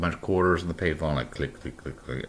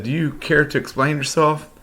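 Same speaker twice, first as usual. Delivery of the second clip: high, prim demand from a mock agent; stern agent; 10 semitones higher, 4 dB louder than usual. Agent pressing the suspect for answers, high and prim. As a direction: lift the pitch like an offended fed.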